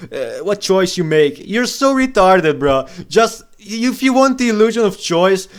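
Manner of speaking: loud, high-pitched angry tirade; exasperated rant. Real, not acted. Ranting at fans of visual novels, exasperated.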